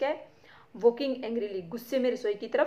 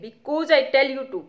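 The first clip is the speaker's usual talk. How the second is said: louder, slow stern shout; 3 semitones higher, 7 dB louder, much slower than usual. Acted. Mother shouting down her son. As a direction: lay down the law, shouting. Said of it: "Mrs Pearson shouting".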